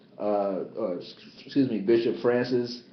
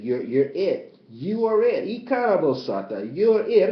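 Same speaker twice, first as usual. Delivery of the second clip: fervent spiritual preaching; impassioned sermon voice; 6 semitones higher, 4 dB louder than usual. Acted. Ecstatic chant of names.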